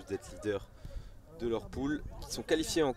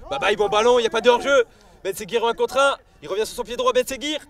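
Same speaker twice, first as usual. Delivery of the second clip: higher and louder, racing the attack; excited sports commentary; 12 semitones higher, 12 dB louder than usual. Real, not acted. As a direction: build up with the chance. Attack building, commentator rises.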